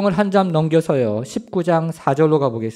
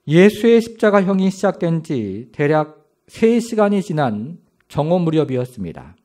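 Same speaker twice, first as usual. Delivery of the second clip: usual speaking voice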